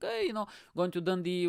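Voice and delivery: high, bubbly mock-cheery voice; like a kid off on a trip; excited. Retelling the excited trip start.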